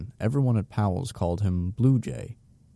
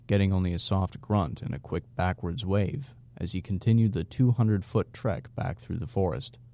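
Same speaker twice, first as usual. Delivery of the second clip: usual speaking voice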